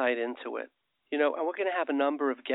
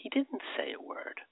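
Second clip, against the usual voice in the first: hushed; 11 semitones higher, 7 dB quieter than usual. Real, not acted. Dramatic pause before punchline.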